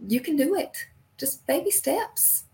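reassuring words to listeners starting out; encouraging. Real, not acted. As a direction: coax them along kindly, step by step.

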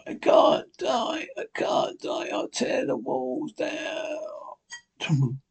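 breathless, panicked begging, voice straining; gasping for air. Acted. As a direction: plead while struggling for breath. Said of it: "Begins to breathe heavily".

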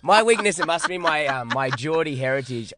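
usual speaking voice

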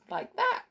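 sharp gasping hiccup. Shown as a demonstration. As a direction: gasp like a hiccup. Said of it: "make a noise in your throat".

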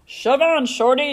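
loud, pushy bus voice; yelled. A narrator's reading. Double-decker bus to little truck, yelled. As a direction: yell it to shove someone aside. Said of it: "yelled a double-decker bus".

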